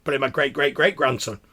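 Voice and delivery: high, loud, fast outraged protest; indignant outburst. Acted. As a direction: bristle with offended disbelief. Crone indignant at "unmolested.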